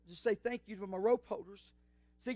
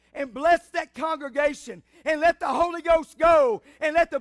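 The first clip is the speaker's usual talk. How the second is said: high, shouted pulpit climax; fervent preaching; 5 semitones higher, 11 dB louder than usual. Real, not acted. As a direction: build from raised preaching to a full shout. Builds to a shouted prayer climax.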